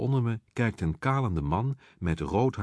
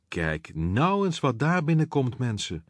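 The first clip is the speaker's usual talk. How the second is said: higher, campy call across the room; drag queen calling out; 6 semitones higher than usual. A narrator's reading. Drag queen on stage calling out to the room at a newcomer, campy and teasing. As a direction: announce the newcomer with camp glee. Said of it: "stopt de travestiet met zingen en roept".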